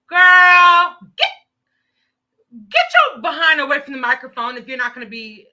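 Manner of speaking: loud, fast furious scolding; angry shout. Real, not acted. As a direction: yell it at them to back off. Scolding officials on live stream.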